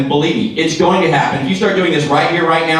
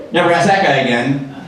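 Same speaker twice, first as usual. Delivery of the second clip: ordinary talk, nothing marked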